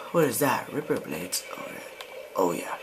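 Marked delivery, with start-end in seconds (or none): 2.3-2.8 suggestive. Acted. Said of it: "That sounded wrong".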